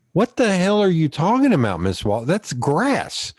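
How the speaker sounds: high-pitched mock disbelief; baffled student protesting, pitch swooping up high; incredulous protest. Retelling disbelief at teacher's hills.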